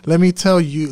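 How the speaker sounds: normal talking voice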